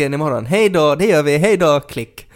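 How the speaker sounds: high, loud sing-off at hang-up; acted phone goodbye. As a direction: chirp a loud, hurried-off goodbye. Acts out ending a phone call.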